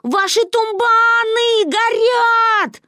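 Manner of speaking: loud, high, drawn-out alarm cry; panicked child bellowing a warning; yelling. A narrator's reading. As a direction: holler it slowly, stretching the vowels. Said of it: "орала я".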